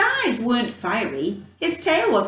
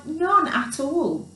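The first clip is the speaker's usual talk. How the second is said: ordinary delivery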